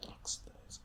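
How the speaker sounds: breathy whisper, pondering the word; a private whispered guess; whispered to herself. Real, not acted. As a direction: whisper it as she thinks. Musing over the guess.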